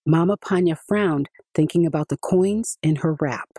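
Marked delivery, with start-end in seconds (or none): none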